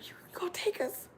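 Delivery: high, breathless hushed ask; excited whisper. Retold or imitated. Teen girls asking older man, hushed and excited. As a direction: gasp it out hopefully.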